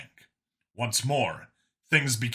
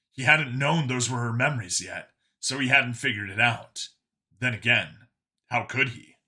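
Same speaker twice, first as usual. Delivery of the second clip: ordinary delivery, nothing marked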